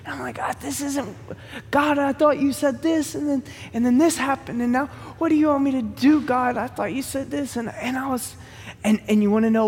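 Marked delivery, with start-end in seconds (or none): 0.4-7.5 higher, whiny complaint to God; self-pitying prayer, pitched up; pity party whine. Retold or imitated. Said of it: "throwing such a pity party".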